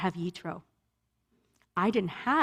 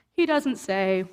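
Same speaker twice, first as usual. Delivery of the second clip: ordinary talk, nothing marked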